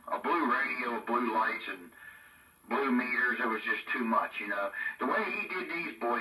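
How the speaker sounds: ordinary talk, nothing marked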